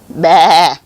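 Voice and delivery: loud wavering baa; lamb's bleat. A narrator's reading. Pig making the lamb's noise, loud bleat. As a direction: bleat it out like a lamb. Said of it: "said the pig".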